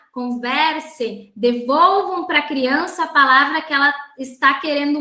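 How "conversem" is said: ordinary delivery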